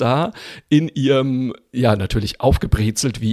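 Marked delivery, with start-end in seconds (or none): none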